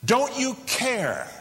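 high, urgent plea of frightened men; panicked cry. Acted. Disciples crying out to sleeping Jesus in the storm, high panicked plea. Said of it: "they cry out".

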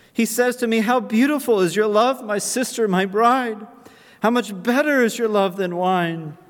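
high and loud, joyful fervour; fervent preaching. Acted. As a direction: lift the congregation with rising joy. Preacher rises in fervent joy.

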